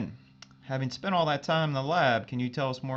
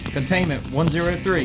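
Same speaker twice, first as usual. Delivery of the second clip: urgent callout; 4 semitones higher, 9 dB louder than usual. Acted. Calling out readings in crisis.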